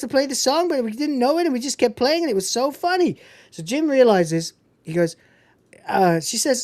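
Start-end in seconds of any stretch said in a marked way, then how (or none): 0.0-3.2 put-on much higher, fast babbling girl voice; chirpy band geek. High eager girl voice as Michelle rambles about band camp to Jim. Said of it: "His future wife, Michelle".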